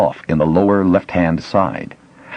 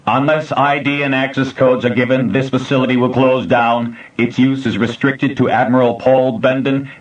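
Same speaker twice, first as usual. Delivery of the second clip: flat machine voice, higher, no inflection; computer voice; 7 semitones higher than usual. A narrator's reading. Facility computer addressing the intruders, mechanical. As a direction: speak like an old computer.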